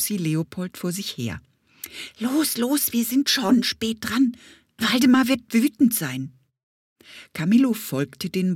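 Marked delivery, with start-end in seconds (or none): 2.2-6.3 high, pressing bee voice; hurrying bumblebee. Acted. Bumblebee rushing Leopold, urgent. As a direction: push them on, no time to lose. Bee hurries them along.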